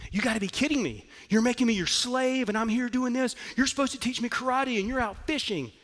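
high-pitched indignant outburst; exasperated, protesting high voice; losing it. Retold or imitated. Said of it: "And he loses it".

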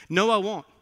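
mock Peter's bold refusal; defiant protest. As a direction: push back loudly, imitating a boast.

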